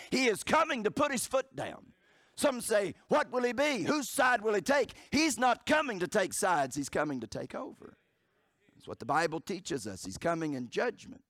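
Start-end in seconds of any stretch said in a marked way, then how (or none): 0.1-8.0 high, loud, ringing preacher's climax; preaching at full force. Acted. Preacher to his congregation, ringing out at full force. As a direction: drive it home to the congregation.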